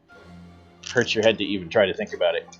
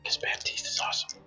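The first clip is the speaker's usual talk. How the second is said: soft unvoiced aside; whispering; 14 dB quieter than usual. Real, not acted. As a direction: breathe it as a secret.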